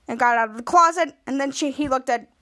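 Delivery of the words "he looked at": ordinary delivery, nothing marked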